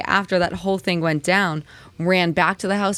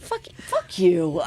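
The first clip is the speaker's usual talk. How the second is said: very high, slow, drawn-out pretend cop; pretending to be a rude police officer; mock rude cop; 13 semitones higher, much slower than usual. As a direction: stretch out the insult playfully.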